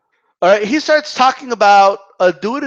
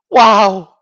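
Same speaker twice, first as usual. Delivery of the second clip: ordinary talk, nothing marked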